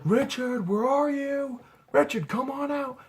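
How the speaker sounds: high, singsong searching call. Retold or imitated. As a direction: call out as if to someone hiding. Calling for a hiding friend.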